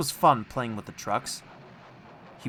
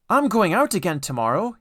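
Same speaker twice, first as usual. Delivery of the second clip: higher, louder happy boast; proud news; 6 semitones higher, 6 dB louder than usual. A narrator's reading. Edward telling the shed his happy news, proud. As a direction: tell them gladly.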